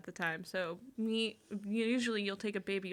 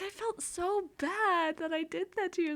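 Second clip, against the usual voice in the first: mock sorry mom; 9 semitones higher than usual. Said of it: "She's like".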